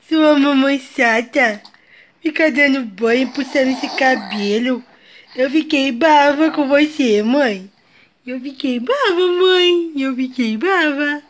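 put-on slow, whiny pouting child; filha emburrada. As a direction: sulk slowly like a grumpy little girl. Mãe faz a voz da filha emburrada. (voz lenta e manhosa de criança emburrada)

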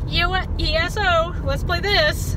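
high, eager self-quote; eager gamer urge. Retold or imitated. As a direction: blurt it out like a tempted gamer. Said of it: "but in reality I'm like".

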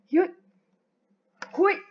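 usual speaking voice

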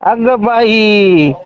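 very slow, stretched exclamation; drawn-out mock surprise.

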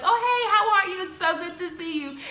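high, sugary fake greeting; fake friendliness. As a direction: gush with fake warmth. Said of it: "Kim being so fake".

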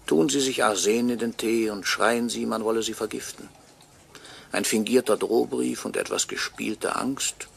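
ordinary talk, nothing marked

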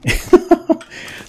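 high airy laugh; airy disbelieving laugh; breathless laugh. Real, not acted. Overloaded with fish.

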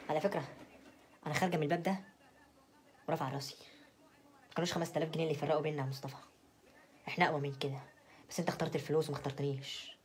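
quietly hurt, with dignity; wounded farewell. Acted. Wife walking out on Mostafa over money, quietly wounded. Mock-dignified parting speech to husband.

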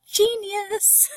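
high, gushing exclamation; delighted admiration. Real, not acted. Teacher raves over Frost's hoofbeat trick, delighted admiration. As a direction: marvel aloud with a laugh about to follow.

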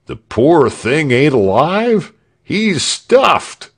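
high, loud cheeky heckler; mocking heckler. A narrator's reading. Heckler joking that Frieshardt is stuffed, high and cheeky. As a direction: play the crowd for laughs.

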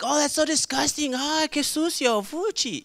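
high-pitched squeamish revulsion; mock disgust. Preacher imitates people reacting to a scandal, disgusted. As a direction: recoil like a scandalized gossip.

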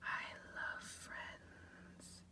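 very slow soft whisper. Acted. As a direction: breathe it out dreamily.

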